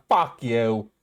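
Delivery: amateur Aussie accent with flattened melody; Australian intonation. Shown as a demonstration. Practising the tune for friends, attempted Australian accent. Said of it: "that's the intonation".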